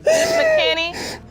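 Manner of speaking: loud high wail, then crying; real crying breakdown; sobbing. Real, not acted. Woman collapses after the result.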